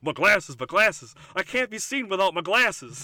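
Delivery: loud, vain Johnny Bravo boast. Retold or imitated. Johnny Bravo fretting about being seen, loud. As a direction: belt it out like a vain hunk. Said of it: "he goes".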